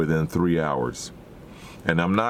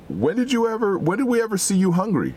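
incredulous; 12 semitones higher than usual. Retold or imitated. Incredulous plea quoted from scripture.

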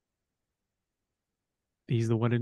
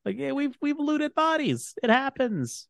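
mock innocent high voice; 12 semitones higher than usual. Mock shrug about looting.

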